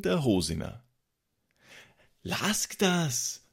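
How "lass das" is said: high-pitched telling-off; firm demand. A narrator's reading. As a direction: order a friend to stop. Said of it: "forderte ich Freund Christoph auf".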